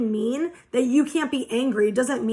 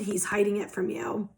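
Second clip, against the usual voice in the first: breathy unvoiced whisper; whispering that he hides it; 3 semitones lower, 6 dB quieter than usual. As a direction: hush it like a secret. Secret kept by the masculine.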